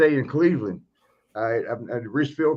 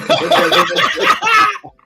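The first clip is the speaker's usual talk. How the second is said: high, loud howling laugh; shrieking laugh; 9 dB louder than usual. Real, not acted. Telling the story of Sheik getting taken down, shrieking laugh. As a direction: burst into helpless shrieking laughter.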